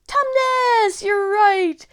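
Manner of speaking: high loud cheer; loud cheering name; really loud. Real, not acted. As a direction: call it out loud. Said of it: "say it really loud".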